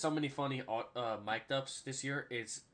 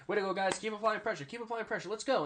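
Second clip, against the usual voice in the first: high-pitched coach hype; acted coach; 8 semitones higher than usual. Coach cheering on his defense, high-pitched mock pep talk. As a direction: imitate a coach urging players on. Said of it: "the coach is like".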